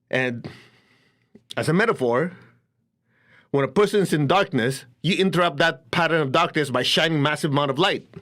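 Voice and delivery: ordinary talk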